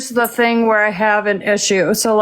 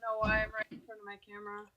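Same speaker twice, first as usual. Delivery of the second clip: distant, faint mic sound; sounding far from the microphone; far away; 15 dB quieter, faster than usual. Real, not acted. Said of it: "You sound like you're, like, down the road screaming".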